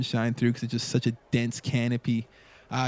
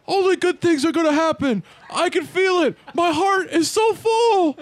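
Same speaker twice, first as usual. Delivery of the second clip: high, loud, ecstatic scream; screaming to the heavens; 6 dB louder than usual. Acted. Glenn tells the heavens his heart is full, screaming. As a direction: shout it skyward, overjoyed, stretching the last words. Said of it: "he screams to the, to the heavens".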